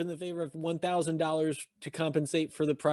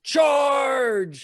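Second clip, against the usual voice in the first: battle cry; 7 semitones higher, 4 dB louder than usual. Retold or imitated. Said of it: "looking at those guns going".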